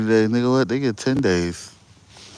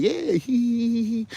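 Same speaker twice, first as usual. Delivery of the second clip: mock giggling landlord; 13 semitones higher, 3 dB louder, slower than usual. Mimicking the smiling landlord.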